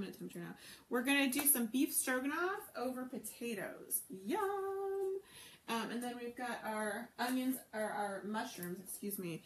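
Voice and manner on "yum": delighted. Real, not acted. Announcing beef stroganoff.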